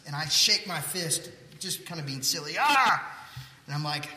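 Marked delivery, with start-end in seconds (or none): 2.6-3.0 loud, high mock yell; mock road rage yell. Driver hamming up road rage at passing cars. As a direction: shake your fist and bellow, hammed-up.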